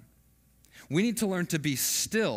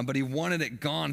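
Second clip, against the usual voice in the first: normal talking voice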